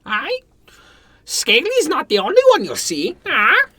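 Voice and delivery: the bird warns the party, high and loud; talking bird. Acted. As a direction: squawk the warning like a parrot.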